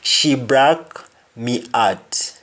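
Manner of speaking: "broke" as "brak", "heart" as "art", slow; Jamaican pronunciation. Shown as a demonstration. Teacher repeating for learners, slow and H dropped. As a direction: slowly, open A in "broke", drop the H in "heart". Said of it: "You simply say break".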